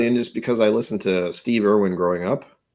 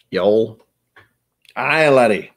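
hearty Scottish burr, rising louder; Scottish accent; 5 dB louder, slower than usual. Acted. Streamer playing with his Scottish accent, hammy burr. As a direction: call it out like a stage Scotsman. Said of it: "that's the Scottish accent I kinda default to".

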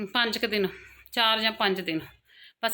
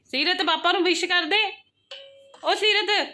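high-pitched coaxing call; 9 semitones higher than usual. Real, not acted. Mother asking child to wish papa, calling out.

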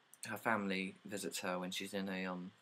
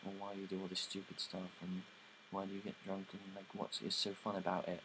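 softer, snooty questioning; prim disapproval of their partying; uppity; 6 dB quieter than usual. Retold or imitated. Pearl looks down on the barmaids' drinking, snooty and soft. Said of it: "like, uppity for them".

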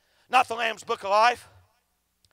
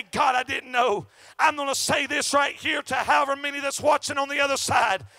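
loud, driving revival preaching; shouting gospel preacher; fiery preaching; 2 semitones higher, 3 dB louder than usual. Acted. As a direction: preach it hard at the crowd. Preaching at full force.

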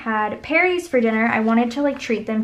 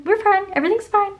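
much higher and louder; high-pitched, overdone all-is-well; breezy reassurance; 10 semitones higher, 4 dB louder, faster than usual. Acted. Sister after yelling at her brother, high-pitched reassurance.

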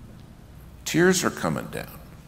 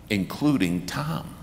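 usual speaking voice

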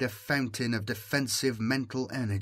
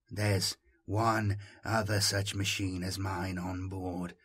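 low, slow and hushed confidence; confiding; 4 semitones lower, 5 dB quieter than usual. A narrator's reading. Voice tells Jomi about the machine, confiding. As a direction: confide it quietly, trailing softer. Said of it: "the voice confided".

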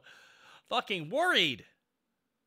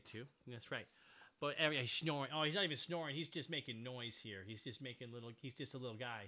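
soft doting voice; 6 semitones lower, 12 dB quieter than usual. Real, not acted. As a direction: coo to the puppy. Talking to dog softly.

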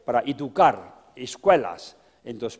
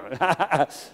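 short real chuckle; laugh; 3 semitones higher than usual. Real, not acted. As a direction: chuckle briefly after your own remark. Laughs at own remark. (risita breve)

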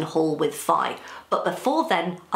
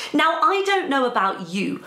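ordinary delivery

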